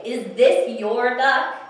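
slow, loud, spaced-out question; emphatic question. Acted. Alligator asks the go-kart person, loud emphatic question. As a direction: ask loudly, leaning on every word.

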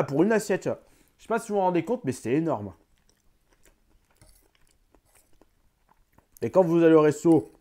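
chewing between words; talking mid-meal; mouth full of food. Real, not acted. Seen 1: Fork in plate throughout; lips pressed as if chewing at 1.6–1.8.

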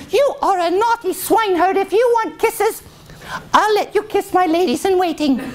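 very high, haughty scolding voice; scolding princess. Acted. Princess scolding the naughty swineherd, very high voice. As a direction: tell him off in a high squeal. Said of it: "her finger and she said".

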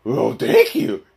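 slow throaty dog thanks, high giggle; Scooby-Doo. Acted. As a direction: lap up the treat, then snicker like a cartoon dog. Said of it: "here's a Scooby snack".

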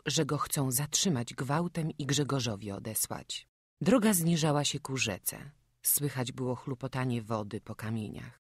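ordinary delivery

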